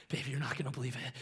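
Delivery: breathy hushed voice into the phone; hushed phone call. Retold or imitated. Man telling wife on phone, hushed and excited. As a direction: whisper the news low to his wife.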